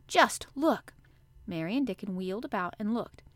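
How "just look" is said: high, urgent, voiced exclamation; excited hushed exclamation. A narrator's reading. Colin pointing to the high wall, excited. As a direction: excitedly urge them to see. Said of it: "he whispered excitedly".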